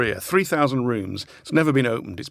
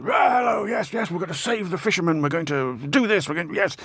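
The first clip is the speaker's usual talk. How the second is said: blustering politician barrelling through a crowd; high, hurried, put-on campaigning bluster; Boris; 5 semitones higher than usual. Guest mimicking the prime minister barrelling through a market, blustering impression. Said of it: "he barrels through the market".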